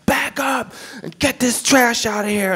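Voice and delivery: high barked order; homeless man yelling. Retold or imitated. Homeless man shoos comedian off, high barked order. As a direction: snap it out like a cranky vagrant.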